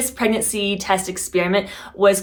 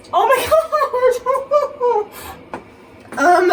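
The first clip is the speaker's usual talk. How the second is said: surprised gasp. Real, not acted. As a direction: burst out in happy disbelief. Sees positive test.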